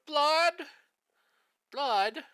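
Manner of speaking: very high, loud mock yell; imitated kid's yell. Showing a friend the old YouTube clip, imitated kid's yell. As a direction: yelp it like the kid in the clip. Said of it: "YouTube video of a kid going".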